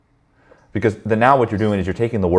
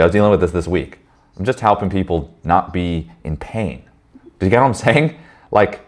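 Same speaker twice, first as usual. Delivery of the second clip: normal talking voice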